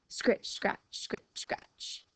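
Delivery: unvoiced whispered scritching; whispered scratch sounds. A narrator's reading. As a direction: breathe the scratching.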